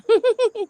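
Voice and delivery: bright, high giggle. Real, not acted. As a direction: let a light giggle bubble out. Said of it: "I love your giggle".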